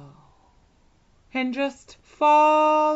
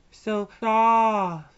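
usual speaking voice

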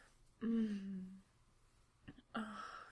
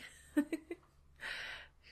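short laugh rising up; breathy little laugh; 7 semitones higher, 7 dB louder than usual. Real, not acted. Laughs at own joke.

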